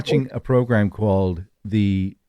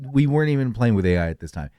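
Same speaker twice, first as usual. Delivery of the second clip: ordinary delivery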